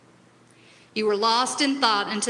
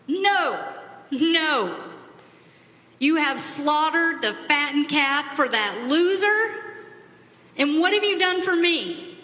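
angry protest; 5 semitones higher than usual. Acted. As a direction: let the resentment spill out, pitched high. Older son raging at father.

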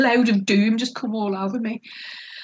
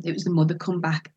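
usual speaking voice

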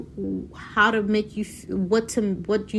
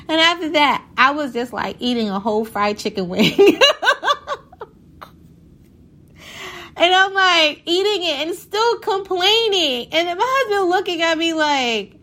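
laughing; 9 semitones higher, 5 dB louder than usual. Real, not acted. Laughing at her own story.

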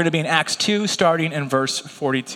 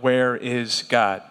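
slow weighty question; 5 semitones lower, much slower than usual. Shown as a demonstration. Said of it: "we've all asked the question".

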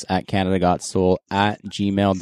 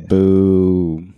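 playful boo; 2 semitones lower, 4 dB louder than usual. Real, not acted. Booing a friend's sneaker pick.

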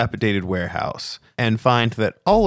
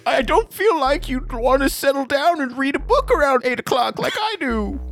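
very high, fussy falsetto; prim cab driver. Acted. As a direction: turn down a suitor as a prim cabbie, pitched far up. Cab driver refuses the flirt.

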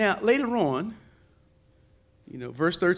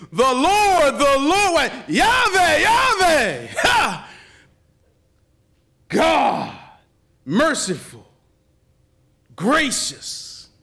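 higher, louder preached proclamation; preaching at full force; 6 semitones higher, 5 dB louder, much slower than usual. Acted. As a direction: ring out the name of the Lord like a herald. Proclaiming the Lord's name.

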